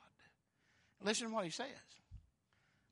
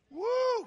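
high, loud "woo"; excited whoop; 10 dB louder than usual. Real, not acted. Preacher rejoicing at the resurrection, excited whoop.

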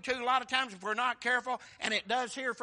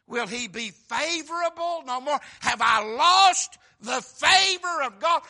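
loud, slow, higher crying question; preached lament; 4 semitones higher, 14 dB louder, slower than usual. Acted. Preacher crying out the psalmist's fear to the church. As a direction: throw the question up to heaven.

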